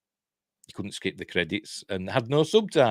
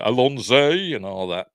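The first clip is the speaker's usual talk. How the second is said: light mock London accent, louder; 6 dB louder than usual. Host mimicking the Doctor's London accent, playful London voice. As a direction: do a quick London catchphrase. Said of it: "Like a, a London accent".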